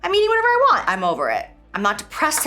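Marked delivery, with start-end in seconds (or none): 0.0-0.9 put-on defiant old self. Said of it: "the next day I'd be like".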